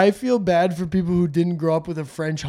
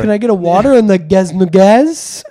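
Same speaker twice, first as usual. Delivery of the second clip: louder, alternating own voice and French waitress; un turista e la cameriera francese, più forte; mock exchange with waitress; 9 dB louder than usual. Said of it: "if you're like".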